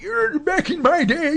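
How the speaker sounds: put-on slow, high creaky old man voice; grumpy old man. Shown as a demonstration. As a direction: whine it like a cranky old-timer. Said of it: "the grumpy old man bit".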